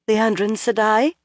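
hesitant, rising uneasy question. A narrator's reading. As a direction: ask it nervously. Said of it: "Egwene asked uneasily".

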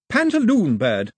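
put-on high, slow girl's voice; lightly voiced girl teaching a word; Daphne naming the bird. A narrator's reading. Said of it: "she said".

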